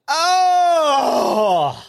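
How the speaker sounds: sudden high yelp of surprise; loud mock shout. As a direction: yell it out like you just walked in on someone. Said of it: "But I always go".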